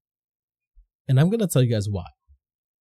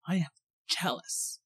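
very soft, breaking it up word by word; hushed, with a pause before the last word; hushed emphasis; 6 semitones higher, 10 dB quieter than usual. Real, not acted. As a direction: drop to a near-whisper to confess it. Repeats the admission for effect.

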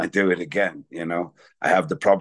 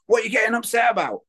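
friend's alarmed shout; faster than usual. Retold or imitated. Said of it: "they're like".

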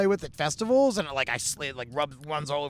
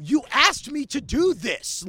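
exasperated, slow; 8 semitones higher, 4 dB louder, slower than usual. Retold or imitated. Exasperated at upset heckler.

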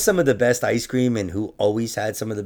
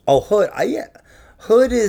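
high, loud delighted oh; suddenly lit up, remembering; excited recognition; 12 semitones higher, 7 dB louder than usual. Real, not acted.

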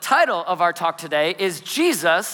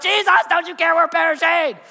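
high, frantic mock plea; hammed-up alarmed pleading; panicked disciples; 9 semitones higher, 4 dB louder than usual. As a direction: cry out to wake a sleeper in a storm. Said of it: "the disciples come to him".

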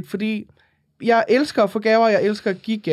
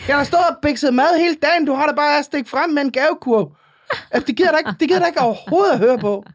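higher-pitched mock indignation; indignant host; 6 semitones higher than usual. Offended host scolding a gift-bringing guest, indignant. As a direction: complain like an affronted cook. Acted complaint of an offended cook.

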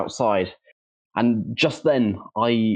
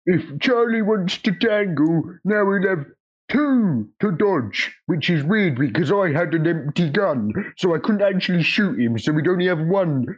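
put-on old-timey old man voice; 6 semitones higher than usual. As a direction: tell it like a rambling old-timer. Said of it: "like, an old-timey old man voice".

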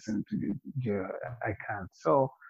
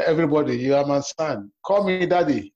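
mock proclamation; 4 semitones higher, 6 dB louder than usual. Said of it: "You say".

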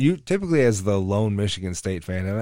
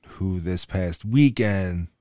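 slow dramatic buildup; 3 dB louder, slower than usual. Real, not acted. Dramatic build to rival loss.

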